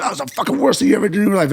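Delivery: high, rapid mock telling-off; mock scolding. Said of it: "Or I'll say something".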